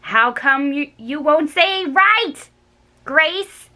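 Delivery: exasperated whiny demand; fed up. A narrator's reading. Girl shaking Grace, fed up and whiny. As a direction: at the end of her rope.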